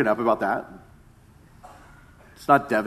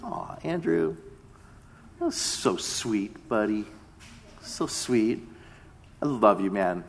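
soft, slow retold fatherly reply; tender; 5 semitones lower, 9 dB quieter, slower than usual. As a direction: melt quietly, as if answering your little son. Father retells answering his little son.

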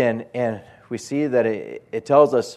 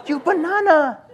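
put-on very high Chinese-accented lady; high mock Chinese accent, firm; 4 dB louder than usual. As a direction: imitate a Chinese lady insisting. Imitates Chinese lady repeating herself.

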